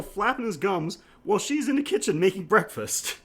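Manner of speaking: usual speaking voice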